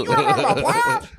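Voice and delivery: high squeaky disbelief; hammed-up astonished question; mock incredulity. Joins in the incredulous rant impression.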